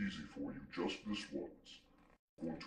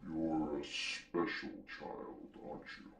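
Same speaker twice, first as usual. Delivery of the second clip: deep-voiced demon; slower than usual. Demon talks down to his host, slow and deep. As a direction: drawl it out in a deep bass.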